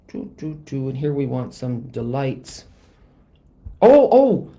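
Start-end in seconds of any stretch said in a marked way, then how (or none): 3.8-4.5 loud, high startled oh; real startled oh; sudden alarm. Real, not acted. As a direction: catch yourself mid-mistake. Realizes she forgot a step.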